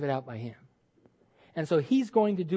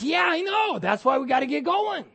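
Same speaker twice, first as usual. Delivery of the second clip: God's cheerful reply; 7 semitones higher than usual. Retold or imitated. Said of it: "He says".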